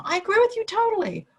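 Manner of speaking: high, fast gushing agreement; mock gushing yes-man. Shown as a demonstration. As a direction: overdo eager agreeing. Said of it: "it's not about agreeing with them".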